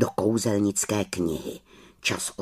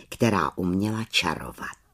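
ordinary delivery, nothing marked

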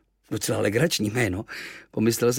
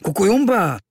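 high, loud reproachful native call; 11 semitones higher, 8 dB louder than usual. Acted. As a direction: scold your friend with his strange words. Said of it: "řekl trochu vyčítavě".

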